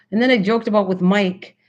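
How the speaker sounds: ordinary delivery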